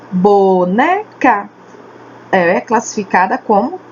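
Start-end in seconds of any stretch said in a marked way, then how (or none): 0.1-1.5 boneca said slowly, syllable by syllable; slow, clear teacher demo. Shown as a demonstration. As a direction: stretch out bo-ne-ca for the class. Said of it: "A palavra boneca tem três sílabas".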